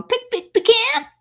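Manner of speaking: high clucking chicken, "Pick, pick, pecan" pecked out. Retold or imitated. Friend explains her pronunciation, clucking like a chicken. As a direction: peck out "Pick, pick" like a hen, then "pecan". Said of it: "reminds me of a chicken going".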